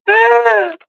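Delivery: sudden high shout of alarm; startled shout. Real, not acted. Owner shouting in alarm as the dogs start fighting. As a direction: yelp out as the dogs lunge.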